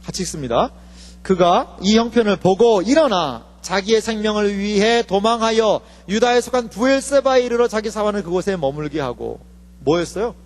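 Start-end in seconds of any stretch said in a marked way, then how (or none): none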